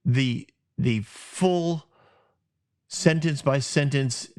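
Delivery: usual speaking voice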